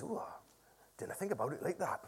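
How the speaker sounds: put-on soft, low Morpeth accent slipping into Welsh; accent gone Welsh. Preacher mimicking builder's realisation, accent drifting into Welsh. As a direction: murmur it in a wobbly Welsh-tinged accent. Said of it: "Gone Welsh".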